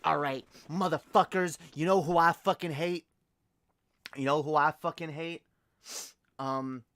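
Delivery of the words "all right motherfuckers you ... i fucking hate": loud, hyped-up fake rant; punchy, louder mock-mean delivery; mean, mock angry. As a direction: lay into it with put-on anger. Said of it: "let's get mean".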